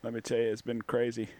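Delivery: Bill voice. Acted. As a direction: play the folksy guest Bill. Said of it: "Thanks, Bill".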